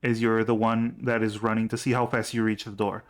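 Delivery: usual speaking voice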